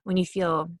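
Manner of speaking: ordinary talk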